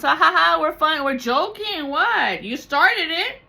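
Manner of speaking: high, loud sneering fake laughter; mocking dismissive family. Family mocking you after hurting you, loud sneering denial. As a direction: brush it off with a mocking cackle. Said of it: "they think it's".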